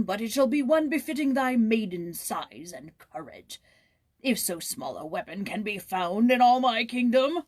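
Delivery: haughty king's taunt; scornful king. Acted. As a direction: belittle the little prince. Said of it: "the king laughed him to scorn".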